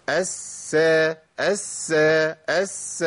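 الس with a long hissed s, then released; lingering soft sin. Shown as a demonstration. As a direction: let the s in الس flow before the vowel. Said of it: "لازم أبين رخاوة السين الساكنة".

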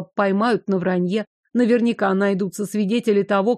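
usual speaking voice

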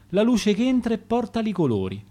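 usual speaking voice

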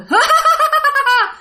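high-pitched eerie cackle; imitated spirit's long high laugh; possessed laugh. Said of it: "ba của bạn cười".

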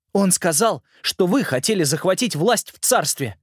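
put-on a boy urgently pleading his case; earnest boy. Simon's lines.

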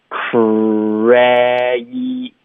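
CRAZY stretched to "krreh-ee", jaw dropped; over-open the vowel in crazy; exaggerated jaw. Shown as a demonstration. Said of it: "夸不夸张". (夸张拉长 crazy 的元音)

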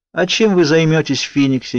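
high-pitched, biting question; caustic. A narrator's reading. Brother questioning his sister about Phoenix, caustic. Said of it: "едко спросил у сестры Калин".

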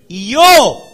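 high, loud burst; loud preaching cry. Acted. Preacher quoting God to congregation, loud high cry. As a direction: shout it out as God's own word.

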